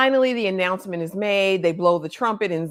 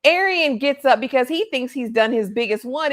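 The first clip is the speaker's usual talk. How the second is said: usual speaking voice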